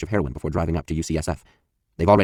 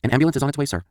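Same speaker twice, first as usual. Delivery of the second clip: higher operator asking quickly; dispatcher voice; 8 semitones higher than usual. Retold or imitated. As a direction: voice the operator on the line. Dispatcher reassures caller.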